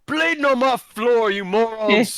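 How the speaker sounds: loud, high angry bark at brawlers; barkeep yelling. Acted. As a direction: yell across the bar.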